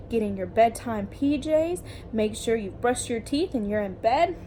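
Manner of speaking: usual speaking voice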